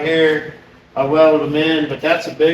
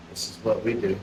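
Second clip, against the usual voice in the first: impression of Jackie; 7 semitones lower, 12 dB quieter than usual. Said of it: "Did I sound like her?".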